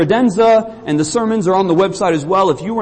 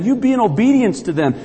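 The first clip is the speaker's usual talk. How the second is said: ordinary talk, nothing marked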